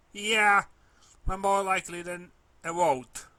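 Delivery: high, loud gloomy retort; gloomy filly. A narrator's reading. Gloomy filly shooting down the pony's hope, high loud sulky. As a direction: answer high and loud, sulking.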